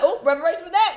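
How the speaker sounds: indignant verdict. Acted. Host acting out a reader's reaction, indignant verdict. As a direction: pass instant judgment on an outrage.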